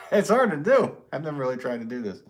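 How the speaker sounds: loud, high burst of laughter; cracking up while speaking; laughing. Real, not acted. Laughing at failed ventriloquism.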